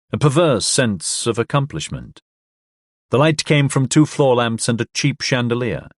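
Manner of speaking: plain reading voice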